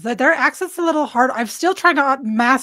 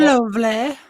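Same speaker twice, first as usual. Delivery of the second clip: Yorkshire lovely; slower than usual. Shown as a demonstration. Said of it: "say again, lovely".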